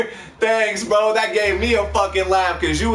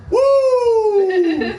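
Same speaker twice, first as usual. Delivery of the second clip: high, loud whooping "woo"; Ric Flair; 5 dB louder than usual. Retold or imitated. Drinker reacting to a strong first sip, wrestler-style whoop. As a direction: let out a long, piercing wrestler's whoop. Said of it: "Like Ric Flair and shit".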